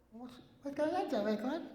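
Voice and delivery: high, soft, lightly voiced friendly woman; a hint of a sweet co-star voice; mock friendly greeting. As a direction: chirp it high and soft, a light take on her. Kathy greeting him by the car.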